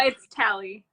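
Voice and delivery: "Tally" with the short a of cat; name correction. Shown as a demonstration. Guest correcting host on her name, "Tally" said TAL-ee. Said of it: "am I pronouncing your name correct".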